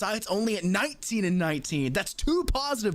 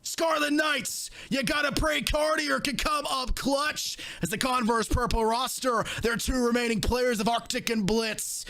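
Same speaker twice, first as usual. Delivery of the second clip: rising shouted play-by-play; esports caster; 5 semitones higher than usual. Acted. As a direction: build the call to a roaring finish. Live play-by-play climax.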